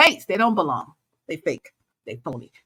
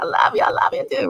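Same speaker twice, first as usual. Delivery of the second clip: playful gushing; faster than usual. Retold or imitated. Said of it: "I'll be like".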